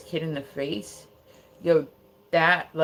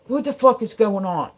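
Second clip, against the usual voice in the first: dad's raised, higher-pitched demand; retold yelling; imitated angry yell; 5 semitones higher, 7 dB louder than usual. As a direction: burst in demanding answers, loudly. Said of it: "yelling at the son".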